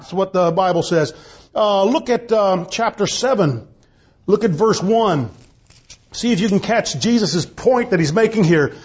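ordinary talk, nothing marked

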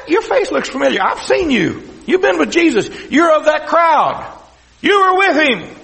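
recognize him and expose him, rising to a shout; mounting, high-voiced accusation; acted accusation. Passer-by accuses Peter.

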